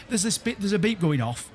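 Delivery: ordinary talk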